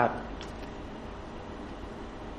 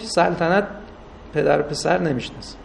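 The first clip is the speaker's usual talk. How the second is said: ordinary delivery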